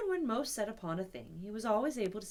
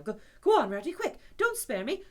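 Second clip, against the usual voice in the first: higher, louder frantic plea; anxious pleading; 9 semitones higher, 9 dB louder than usual. Acted. Toad frantic for the news, higher and louder. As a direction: beg him to spill it. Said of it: "trembling in every limb".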